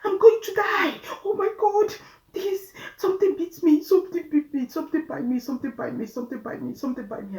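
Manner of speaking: high, loud panicked shrieking that fades; screaming in terror from a bite. Retold or imitated. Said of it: "Becca screamed from the bathroom".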